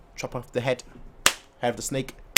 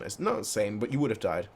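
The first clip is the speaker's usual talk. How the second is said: ordinary talk, nothing marked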